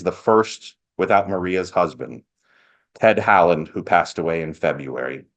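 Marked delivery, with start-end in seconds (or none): none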